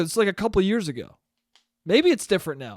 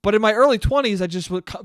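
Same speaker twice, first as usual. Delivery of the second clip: ordinary talk, nothing marked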